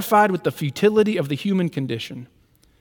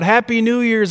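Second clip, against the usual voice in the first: higher, louder call across the path; shouted greeting; 5 semitones higher, 4 dB louder than usual. Retold or imitated. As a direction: call it out to a passing runner. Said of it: "I shouted out".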